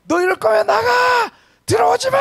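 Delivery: high, loud screaming scold; mother's shrill shout. Retold or imitated. Mother screaming at her son to get out. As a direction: scream it like an enraged mom. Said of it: "막 소리를 지르-, 지르는 겁니다".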